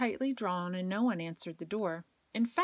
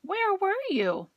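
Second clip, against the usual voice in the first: much higher, eager question; excited kids; 13 semitones higher, 6 dB louder than usual. A narrator's reading. Kids greeting returning teacher, high and excited. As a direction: burst out with joy.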